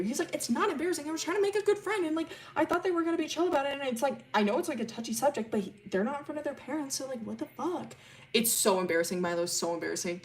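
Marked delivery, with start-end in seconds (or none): 0.3-7.9 high-pitched protesting ramble; defensive whining. Retold or imitated. Milo defending himself to Arden, high and defensive.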